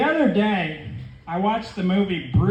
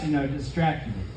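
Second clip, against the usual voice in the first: ordinary talk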